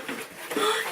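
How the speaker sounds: high, sharp breath in; a real gasp of surprise; delighted gasp. Real, not acted. Gasping at the little bear house, real surprise.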